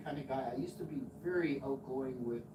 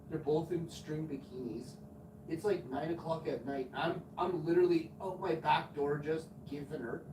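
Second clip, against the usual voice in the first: usual speaking voice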